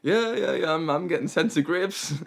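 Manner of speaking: high, loud mock agreement; playful piss-take of a wine taster; teasing mockery. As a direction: send up the wine snob. Mocks the American wine taster.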